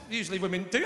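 ordinary talk

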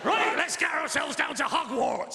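big, slow shouted storybook voice; character voice. Acted. Dad guessing Hermione's voice for the kids, hammed-up. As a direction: overdo it as a booming reader. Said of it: "do all the character voices".

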